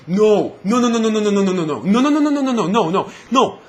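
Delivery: loud, high string of no's; frantic no. Real, not acted. Gamer rejecting Microsoft's spin, loud and high. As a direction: shout it down, over and over.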